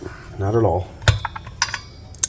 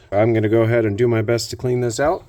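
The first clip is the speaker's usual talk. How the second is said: usual speaking voice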